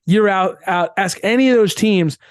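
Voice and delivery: ordinary talk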